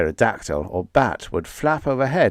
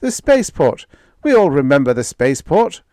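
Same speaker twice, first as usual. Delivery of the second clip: loud, higher shout; 6 semitones higher, 7 dB louder than usual. Acted. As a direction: call it out. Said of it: "shouted Brunet".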